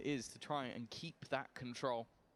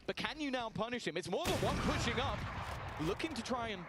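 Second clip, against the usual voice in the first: high, loud play-by-play hype; esports caster; 9 semitones higher, 13 dB louder than usual. Acted. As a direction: call the round at full tilt. Caster hype through the round.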